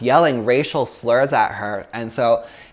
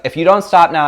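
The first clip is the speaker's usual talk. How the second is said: raised warning; 6 semitones higher, 7 dB louder than usual. Retold or imitated. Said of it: "And I was like".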